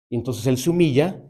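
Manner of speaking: ordinary talk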